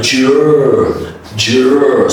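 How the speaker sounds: "juror" as a drawn-out j-r sound; DR rule. Shown as a demonstration. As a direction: stretch "juror" to show how DR sounds. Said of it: "sounds like juror".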